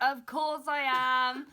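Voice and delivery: mock indignant, drawn out and loud; exasperated loud retort. Telling mum over the phone, a slow loud indignant reply.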